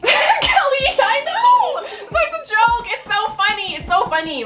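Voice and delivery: very high, loud laughing squeal. Real, not acted. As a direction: squeal through uncontrollable giggles. Cracking up at a joke.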